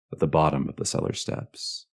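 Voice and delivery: ordinary delivery, nothing marked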